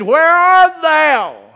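slow, high, loud divine call; booming call of God. Acted. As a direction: call out like God searching the garden. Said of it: "God came to the garden and said".

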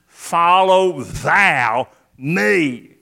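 "thou" drawn out and leaned on; slow phrase, weight on "thou"; stress on THOU. Shown as a demonstration. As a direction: linger firmly on the word "thou". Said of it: "we could emphasize the word".

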